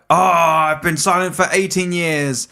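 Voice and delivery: high, slow mock wail of complaint; aggrieved hermit. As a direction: play an outraged hermit, dragging the words.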